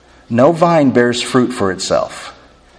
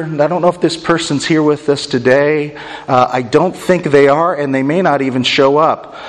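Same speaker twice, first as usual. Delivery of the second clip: ordinary delivery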